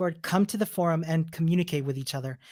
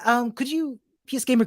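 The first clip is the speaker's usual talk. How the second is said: ordinary talk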